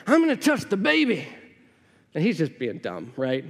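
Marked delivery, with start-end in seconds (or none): 0.1-1.3 put-on taunting teen boy, higher. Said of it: "he's like".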